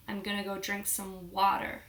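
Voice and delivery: imitated American accent, "water" as "wah-der". Shown as a demonstration. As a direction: Briton putting on an American accent. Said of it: "in America, they pronounce it very differently".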